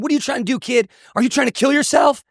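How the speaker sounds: high, urgent questioning, retold; alarmed state trooper. Trooper confronts distressed driver.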